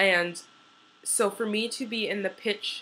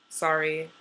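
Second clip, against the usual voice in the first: ordinary delivery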